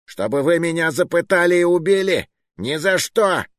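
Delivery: high-pitched, panicky protest; frantic refusal. A narrator's reading. Cornered man refusing to hand over the pistol, frantic. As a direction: refuse wildly, voice pushed high.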